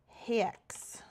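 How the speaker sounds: whisper. Real, not acted. Murmured search term.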